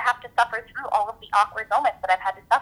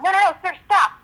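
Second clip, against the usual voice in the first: scripted stage reprimand; 9 semitones higher than usual. Acted. Performer stopping a volunteer charging the stage, mock reprimand. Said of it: "come up with this line".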